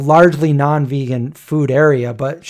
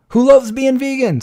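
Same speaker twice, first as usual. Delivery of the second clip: excited call-out; 9 semitones higher than usual. Real, not acted. Cheering up chat viewers.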